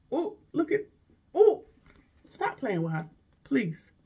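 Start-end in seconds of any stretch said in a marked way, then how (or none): 0.1-1.6 gasp. Real, not acted. Gasping at the bag.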